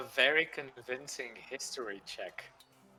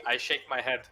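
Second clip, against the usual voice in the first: ordinary delivery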